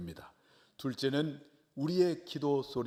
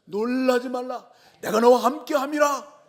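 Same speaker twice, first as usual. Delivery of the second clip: booming promise; 12 semitones higher, 12 dB louder than usual. Retold or imitated. God answering the praying believer, booming and commanding. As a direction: thunder out God's assurance. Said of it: "이렇게 응답을 해주시는 거예요".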